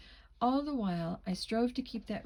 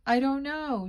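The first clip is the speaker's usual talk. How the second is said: higher, louder acted wail; 6 semitones higher, 8 dB louder than usual. A narrator's reading. Girl bursts out crying when asked who she is, wailing. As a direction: wail it out, hammed-up distress. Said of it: "she wailed".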